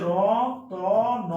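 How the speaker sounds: রতন stretched very long, every vowel held out; drawn-out pronunciation. Shown as a demonstration. Teacher showing students the vowel in রতন, very drawn out. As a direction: sing out রতন slowly so the hidden অ is heard. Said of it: "তাহলে কি হচ্ছে?".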